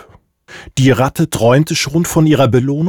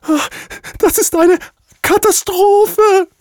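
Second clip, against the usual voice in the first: squeaky duck voice. Acted. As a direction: squeal it like a panicked goose. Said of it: "quietschte Jemima".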